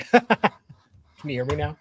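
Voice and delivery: laughing. Real, not acted. Testing mic with friend.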